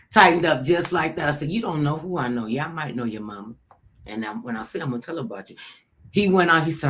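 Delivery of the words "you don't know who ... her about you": quiet, low threat; low warning. Retold or imitated. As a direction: warn him low and steady.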